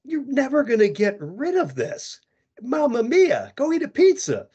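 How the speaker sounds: exaggerated Italian accent, throw hands up; jolly Italian pizza chef. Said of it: "I'm Italian... what I say".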